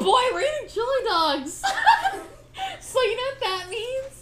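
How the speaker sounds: high-pitched giggly talk; giggling through the words. Real, not acted. Giggling high while eating.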